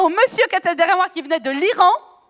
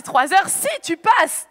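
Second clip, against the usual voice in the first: stretched out slow, each word weighed; slow disbelief; 3 semitones higher, much slower than usual. Retold or imitated. Repeating the German man's words to the audience, slow and stunned. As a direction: drag it out in shocked disbelief. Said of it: "J'ai dit".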